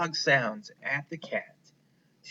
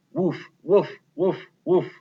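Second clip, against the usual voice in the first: higher, louder barked woofs; loud barking; 5 semitones higher, 5 dB louder than usual. Acted. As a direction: bark to scare the cat. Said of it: "She barked at the top of her voice".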